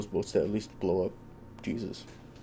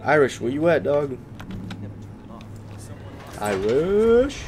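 loud call rising at the end; 5 semitones higher, 4 dB louder, slower than usual. Real, not acted. Streamer calling for Irish across the ship, loud calling out. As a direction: shout a name across the ship.

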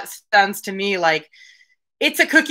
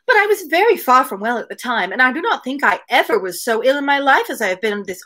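Mary's lines read a little higher, in a lightly voiced complaining tone; dialogue read aloud; 4 semitones higher than usual. Podcaster reading Mary's complaints to Anne, lightly voiced.

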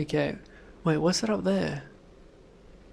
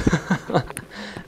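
breathy laugh. Real, not acted. Relieved laugh.

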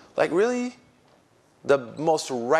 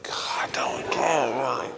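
exasperated whisper. Retold or imitated. Preacher retorts to the hijab thrower, exasperated whisper.

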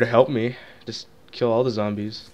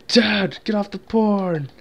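mock yell; 9 semitones higher, slower than usual. Shown as a demonstration. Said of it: "And then it's gonna cut to my actual intro".

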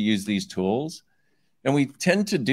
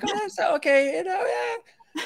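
very high, wavering mimicked brush-off; mock noncommittal. Coach mimics listeners shrugging off an invitation, mock noncommittal. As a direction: imitate a feeble maybe, pitched way up with a laugh.

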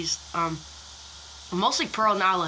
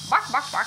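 mock barking; 8 semitones higher, 4 dB louder than usual. Barking at the dog.

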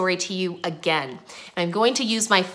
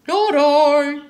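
loud, high duck voice; storybook duck; 7 semitones higher, 5 dB louder than usual. A narrator's reading. Duck refuses the trip to the mill, loud high voice. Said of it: "said the duck".

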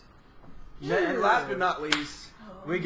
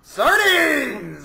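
loud, raised cry of the ingredient; excited call-out; 5 semitones higher, 5 dB louder than usual. Real, not acted. Host announcing the sardines to the room, excited call-out. Host cries out a bad draw.

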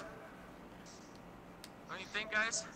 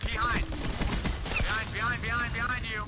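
urgent warning shout; 6 semitones higher, 5 dB louder than usual. Real, not acted. Warning teammate of enemy behind.

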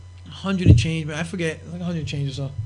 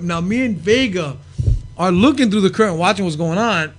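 ordinary delivery, nothing marked

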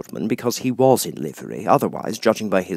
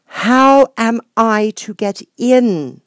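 louder; 9 dB louder, much slower than usual. Acted. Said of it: "in a louder tone".

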